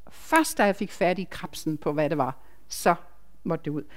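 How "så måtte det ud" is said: ordinary talk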